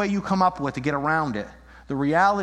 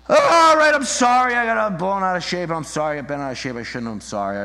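mock confession; 4 dB louder than usual. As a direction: cry out sorry, then let it run down. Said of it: "the Hebet confession".

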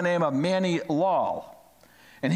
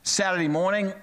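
usual speaking voice

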